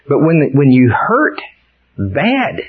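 normal talking voice

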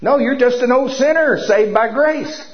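a hint of a stern old-time churchgoer; old church scolding. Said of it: "they'd throw you out of church".

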